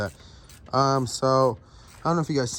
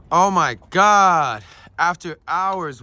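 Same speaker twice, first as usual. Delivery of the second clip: excited exclamation; 6 semitones higher, 10 dB louder, much slower than usual. Real, not acted. Finally got the bolts after hours.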